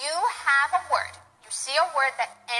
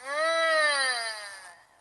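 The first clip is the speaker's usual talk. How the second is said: closed-mouth hum through the nose; humming mm. Shown as a demonstration. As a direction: hum it like tasting food. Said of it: "make the sound through your nose like this".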